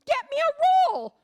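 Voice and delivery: high, loud exasperated yell; wife yells at husband for toilet paper. Acted. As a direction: shout at him to bring a roll.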